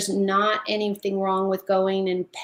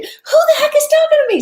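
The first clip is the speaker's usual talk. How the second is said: much higher, fast, bewildered; startled; 9 dB louder, faster than usual. Retold or imitated. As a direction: squeal it like you can't find who spoke. Retold own startled thought.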